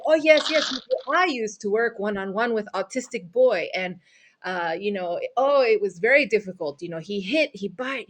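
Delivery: mock Italian accent, excited at the start; chatty, badly faked Italian accent; trashed Italian accent. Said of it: "trash an Italian accent".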